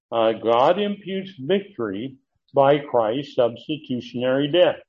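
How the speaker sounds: normal talking voice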